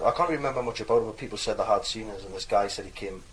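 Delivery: ordinary delivery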